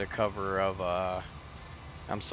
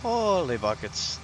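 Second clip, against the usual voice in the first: playful fake Irish accent. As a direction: ham up an Irish brogue, then settle. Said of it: "a quick little good Irish accent".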